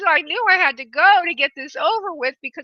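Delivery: high, mildly mock-plaintive voice; comic exasperation. Medium voices mother explaining quick exit, comic exasperation. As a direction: playfully plead to get it over with. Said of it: "She has a little sense of humor".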